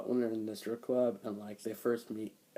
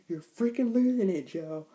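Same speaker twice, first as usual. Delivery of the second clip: much higher, loud mock scream; retold scream; 8 semitones higher, 9 dB louder than usual. Wife screaming at Joe, much higher and loud. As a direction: scream it at him in a hammed-up panic. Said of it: "and she's like".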